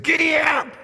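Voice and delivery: high impatient yell of giddy ap; impatient shout. Retold or imitated. Rider yelling at a horse that won't move, impatient shout. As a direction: bark it at the horse, fed up. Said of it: "He gets a little impatient".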